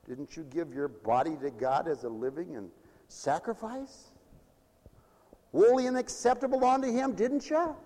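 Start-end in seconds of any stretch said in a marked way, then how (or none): none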